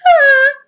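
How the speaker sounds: high, loud scream. A narrator's reading. Ladies caught in the shower, screaming. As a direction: shriek under the spray. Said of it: "All the ladies screamed too".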